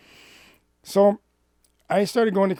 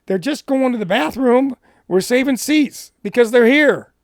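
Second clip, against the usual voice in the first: louder, higher insistent retort; defending saved seats to a stranger, raised and insistent; retold heated protest; 8 semitones higher, 9 dB louder than usual. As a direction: push back hard, voice up and loud. Arguing over saved seats.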